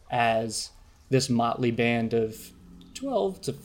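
ordinary delivery

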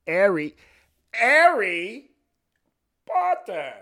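high-pitched mock British, H dropped; hammed-up British accent, stretched and spaced out. Imitating a British actor's Harry Potter line.

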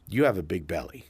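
a hint of a small child stating a fact; little girl's blunt remark. Four-year-old girl to a big man, mildly voiced child. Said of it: "and she goes".

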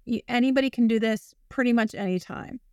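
ordinary talk, nothing marked

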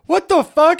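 high, loud incredulous outburst; hammed-up disbelief; mock shock. Visitor reacting to rivers of lava, shocked.

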